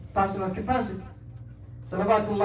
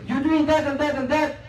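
raised, higher-pitched accusing voice; public scolding; 6 semitones higher, 3 dB louder than usual. Acted. Telling someone off in the middle of the masjid, scolding. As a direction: call someone out in front of everyone.

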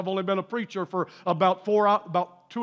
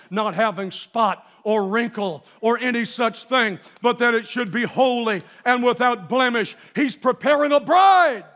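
preaching to a climax; 3 semitones higher, 5 dB louder than usual. Real, not acted. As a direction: build steadily, then belt out the last line.

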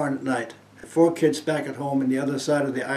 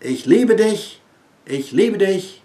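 higher, louder voice speaking German; lightly voiced heavenly call, louder and higher; divine voice; 5 semitones higher, 4 dB louder than usual. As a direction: ring out like a voice from above. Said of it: "And the voice said".

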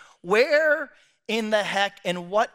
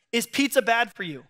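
ordinary delivery

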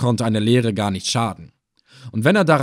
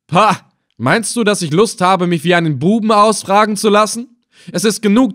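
loud, higher-pitched indignant retort; haughty defiance; scornful refusal; 8 semitones higher, 6 dB louder than usual. Acted. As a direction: scoff and brush off the questioner. Captive scornfully refuses to be questioned.

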